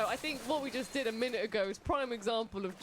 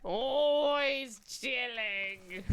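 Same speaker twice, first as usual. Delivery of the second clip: drawn out; much slower than usual. Pretend. Drawn out teasing.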